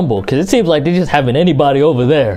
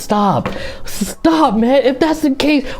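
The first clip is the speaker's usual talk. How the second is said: exasperated; 7 semitones higher than usual. Real, not acted. Exasperated at the attorney excuse.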